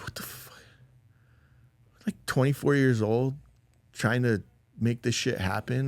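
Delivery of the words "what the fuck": hushed, voiceless swearing; whispered disbelief. Retold or imitated. Dad reacting to twenty seizures, whispered disbelief. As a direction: re-enact stunned disbelief under the breath.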